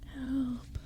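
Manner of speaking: high, faint whispered cry; a tiny voice calling from far away; whispered call for help; small whispered plea. Acted. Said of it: "whispered a voice".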